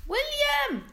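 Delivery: high, loud call across the distance. A narrator's reading. Father calling out to his shrunken son, high and loud. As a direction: shout the name to reach him. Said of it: "rief der winzig geschrumpfte Mister Trudel".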